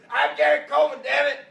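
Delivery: high, loud indignant yelling; shouting to get past the line; imitating a scream. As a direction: yell it like a furious little star denied entry. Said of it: "he's screaming".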